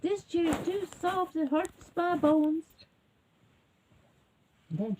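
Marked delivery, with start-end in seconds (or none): 0.0-2.7 high, drawn-out mock wail; complaining little girl. A narrator's reading. Little girl trying the next chair, high-pitched complaining. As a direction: act out a girl's loud, high complaint. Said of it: "and she sat in it".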